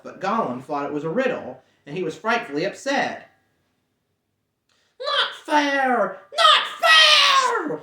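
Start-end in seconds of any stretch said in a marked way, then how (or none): 5.0-7.8 very high, loud, outraged creature shriek; hissing protest. Acted. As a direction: spit out the complaint. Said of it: "he hissed".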